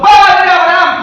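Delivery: high, loud call to a father figure; acted cry. Rich man calling to Abraham in torment, acted shout. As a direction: shout it out, pitched well up. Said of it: "¿Cómo se imagina este hombre gritando".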